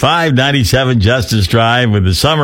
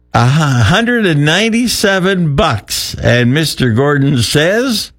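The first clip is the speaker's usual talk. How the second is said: ordinary talk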